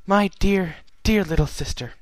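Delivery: lightly voiced, loving older sister; tender Celestia. Celestia embraces Luna.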